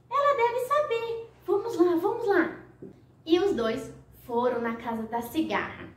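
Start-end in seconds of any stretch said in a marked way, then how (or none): 0.1-2.6 a high, eager snail voice. Acted. Snail urges the butterfly to go, high and cheerful. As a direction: hurry her along with a cheerful snail voice. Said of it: "O caracol então falou".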